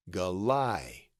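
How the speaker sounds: galai said guh-LIE, rhyming with sky; sounded-out syllables. Shown as a demonstration. Teacher applying the key to Goliath, galai as guh-LIE. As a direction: sound out galai with a long I ending. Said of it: "is pronounced as the Y in sky".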